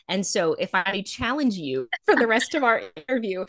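ordinary delivery

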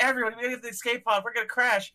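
hurried, high-pitched mock alarm; Havoc. Havoc warning the crew of a crash, mock freak-out. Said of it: "while they're freaking out".

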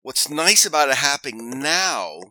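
ordinary delivery

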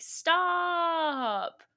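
high, drawn-out "stop"; mock yell. Angry pursuer to fleeing woman, long high mock yell. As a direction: stretch it out in a high cry.

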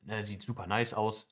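normal talking voice

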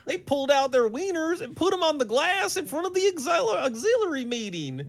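very high, loud West Texas cop voice; a hammed-up West Texas lawman reporting; gossiping small-town cop, West Texas accent. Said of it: "my West Texas accent".

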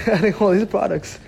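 retold disbelief, high-pitched; incredulous protest. Protesting at being told no.

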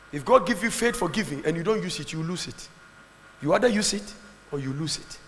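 normal talking voice

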